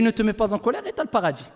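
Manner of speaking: low, soft and quick; understated, hushed rendering of counsel; quiet quoted translation. Retold or imitated. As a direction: relay the promise softly, briskly.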